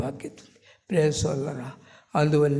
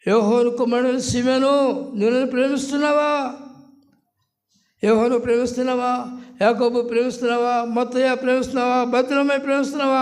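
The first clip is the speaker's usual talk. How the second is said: higher, insistent asking; the Lord pleading with Simon, higher and insistent; pleading question; 6 semitones higher than usual. Acted. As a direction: press the question again and again, higher. Jesus questions Peter.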